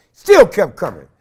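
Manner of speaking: normal talking voice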